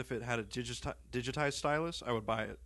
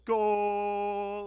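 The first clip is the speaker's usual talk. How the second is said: long, high, drawn-out shout; mock goal yell; 8 semitones higher, 4 dB louder than usual. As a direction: hold it like a soccer goal call. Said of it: "you yell".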